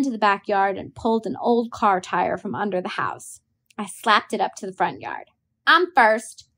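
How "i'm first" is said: claiming first. A narrator's reading. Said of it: "I said".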